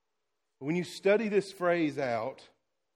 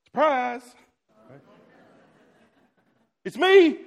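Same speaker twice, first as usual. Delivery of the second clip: high, loud playful "surprise, it's me"; hammed-up joyful reveal, much higher and louder; excited surprise; 8 semitones higher, 9 dB louder than usual. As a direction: burst in like a surprise party. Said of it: "things that I would have said".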